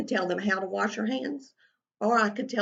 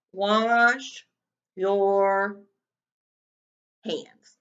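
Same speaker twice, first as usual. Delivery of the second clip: word by word, louder; slow dictation; 6 dB louder, much slower than usual. Shown as a demonstration. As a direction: read each word as written. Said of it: "Let's sound that word out".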